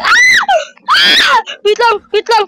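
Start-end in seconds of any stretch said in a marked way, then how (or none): 0.9-1.4 very high shrieking cry of fright; terrified scream. Real, not acted. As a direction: shriek as it grabs you. Caught by monster, screaming.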